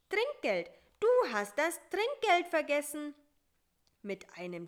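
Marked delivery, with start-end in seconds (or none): 0.1-3.1 high, loud exclamation; calling out. A narrator's reading. Fly reminds spider about the tip, calling out high. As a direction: cry it out high and urgently. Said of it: "rief Bissi".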